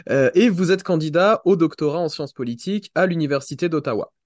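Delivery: normal talking voice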